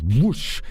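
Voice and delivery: imitated fiery whoosh; whoosh of flames. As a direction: make the sudden burst of a grease fire. Imitates stove bursting into flame.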